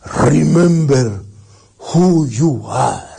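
slow, solemn father's call with long words; Mufasa. Acted. Father's image speaking to his son, slow and solemn. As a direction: draw each word out like a weighty command. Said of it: "de Mufasa, que le dice".

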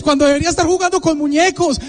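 ordinary talk

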